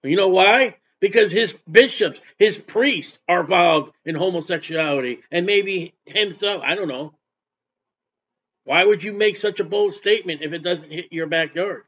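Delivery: ordinary talk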